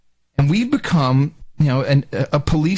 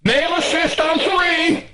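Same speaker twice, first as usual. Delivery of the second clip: high, slow official call; mock agent call-out; 14 semitones higher than usual. Agent calling across the checkpoint, high slow announcement. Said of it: "it's like".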